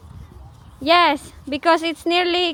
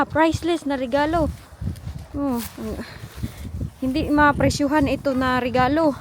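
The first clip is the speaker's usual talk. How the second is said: ordinary delivery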